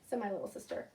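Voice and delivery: ordinary delivery, nothing marked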